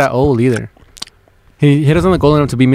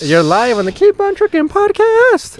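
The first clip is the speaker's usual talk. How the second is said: high, stretched-out mock radio intro; podcast host announcement; 9 semitones higher, slower than usual. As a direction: announce the caller on air with big showmanship. Greets caller live on air.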